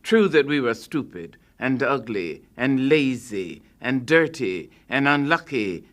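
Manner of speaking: ordinary delivery, nothing marked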